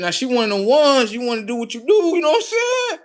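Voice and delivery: high, loud, worked-up rant; excited rant. Retold or imitated. Father hyping up his son, pitched high and loud.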